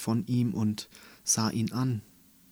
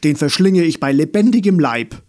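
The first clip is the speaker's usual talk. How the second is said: fast, much louder and higher mock threat; 5 semitones higher, 12 dB louder, faster than usual. Clarence threatening the teary room in a loud hammed-up roar. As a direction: bellow a comic threat to eat them alive. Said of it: "warnte er".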